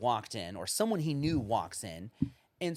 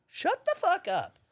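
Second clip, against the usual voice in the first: much higher, drawn out, mock yell; exasperated outburst; 4 dB louder than usual. Ranting about loud strangers, slow high mock yell. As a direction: let the rant boil over on purpose. Climax of his rant.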